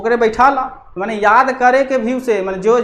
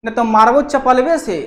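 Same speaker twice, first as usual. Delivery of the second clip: ऊँची डाँट वाली चीख; angry scolding; slower than usual. Acted. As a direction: scold a naughty child, snapping. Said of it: "इसको कह रहा है कि".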